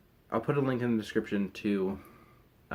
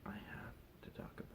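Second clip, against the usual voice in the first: whisper; 23 dB quieter than usual. Real, not acted. Aside to self.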